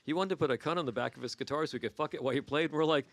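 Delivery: ordinary delivery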